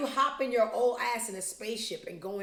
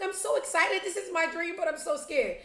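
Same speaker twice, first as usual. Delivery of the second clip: high, louder mock-gushing voice; imitated nervous excitement; 10 semitones higher, 5 dB louder than usual. Vlogger mimicking the TV host before spaceflight, high mock excitement. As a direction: gush it in a raised, mock-eager pitch. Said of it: "She's like".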